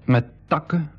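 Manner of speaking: slow, even storyteller's read; audio-drama narrator; story narration. A narrator's reading. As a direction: narrate calmly to the listener. Narrator tells the camouflage.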